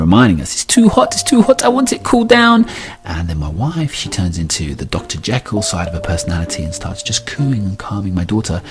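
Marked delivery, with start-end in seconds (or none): 0.5-2.7 high, loud imitated toddler whine; complaining toddler. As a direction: nag like an insistent small child. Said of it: "my daughter kicks up again".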